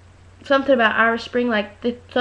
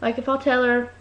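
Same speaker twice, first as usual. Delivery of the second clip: usual speaking voice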